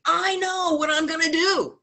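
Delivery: high, bursting with a sudden idea; excited announcement. Retold or imitated. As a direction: blurt it out like the answer just hit you. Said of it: "And he's like".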